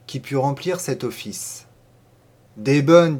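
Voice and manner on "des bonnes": a louder, lightly voiced countrywoman; confident farmer's wife. A narrator's reading. Farmer's wife answering a neighbour who needs a maid, confident. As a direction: answer readily, a notch louder.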